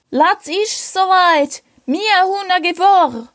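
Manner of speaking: high, slow, snappy military shout. Acted. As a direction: bark it out like an eager soldier. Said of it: "brüllte er schneidig".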